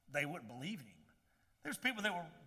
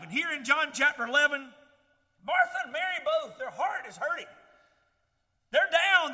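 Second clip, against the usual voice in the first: loud, ringing preacher's delivery, pitched up; full-force preaching; 5 semitones higher, 8 dB louder than usual. Acted. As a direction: preach it out to the whole congregation.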